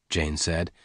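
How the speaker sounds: ordinary delivery, nothing marked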